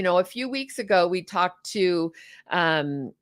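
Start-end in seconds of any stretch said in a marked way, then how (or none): none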